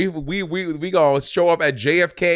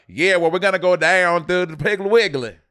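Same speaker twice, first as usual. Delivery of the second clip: mock Atlanta accent; 4 semitones higher, 6 dB louder than usual. Co-host teasing Atlanta host with an exaggerated Atlanta accent. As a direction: lay the Atlanta twang on thick and loud. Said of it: "She had to hear other things like".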